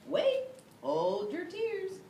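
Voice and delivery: high loud wait, slow hold your tears; eager high call out; excited interruption. Acted. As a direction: stop them from crying. Narrator interrupts listener.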